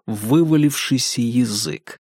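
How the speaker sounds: ordinary delivery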